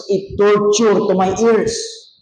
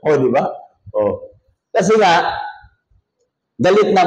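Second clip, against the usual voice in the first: usual speaking voice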